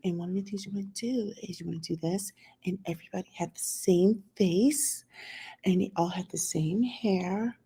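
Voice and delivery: hushed, breathy mock-soft voice; mock feminine whisper; mocking soft talk. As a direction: imitate the soft-life women's hush, half-whispered. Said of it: "they would talk like this".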